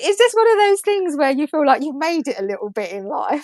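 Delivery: words bubbling with real laughter; laughing while talking. Real, not acted. Telling the co-host a funny story, laughing. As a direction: laugh through the whole line.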